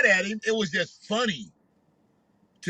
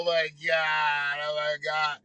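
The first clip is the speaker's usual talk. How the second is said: imitating breathy swoon; 5 semitones lower, 5 dB quieter than usual. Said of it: "Boy sound like he is wide the fuck open".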